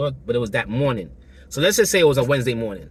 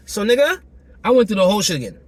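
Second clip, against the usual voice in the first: exasperated; 6 semitones higher, 6 dB louder than usual. Real, not acted. Emphatic climax of story.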